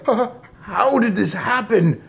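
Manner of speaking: high-pitched stuttered question; aghast stammer. A narrator's reading. Father asks how son became a mouse, stammering. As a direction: stumble into the question, appalled. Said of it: "stammered Mister Jenkins again".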